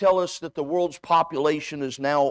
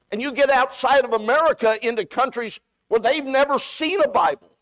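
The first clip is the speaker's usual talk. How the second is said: preaching higher and louder, building; rising preaching; 5 semitones higher, 4 dB louder than usual. Acted. Preacher stirring the congregation toward missions, building force.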